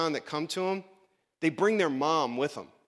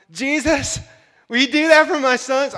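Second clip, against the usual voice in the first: high, loud pleading mother voice; pushy mother; 10 semitones higher, 11 dB louder than usual. Retold or imitated. Mother begging Jesus for her sons, loud and high. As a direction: beg like a mom pushing for her boys. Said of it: "Here's Mama".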